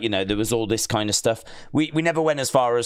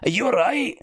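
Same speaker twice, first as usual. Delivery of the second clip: put-on high, lively Scottish accent; light Scottish pal impression; 9 semitones higher than usual. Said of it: "same kind of thick Scottish accent as Edward".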